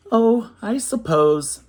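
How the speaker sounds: reluctant. Acted. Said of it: "Max muttered".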